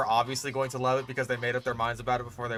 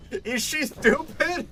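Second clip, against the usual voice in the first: slow, very high-pitched disbelief; incredulous; 11 semitones higher, slower than usual. Real, not acted. Ranting about a character's college choice, incredulous. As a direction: stretch it out in exasperated disbelief.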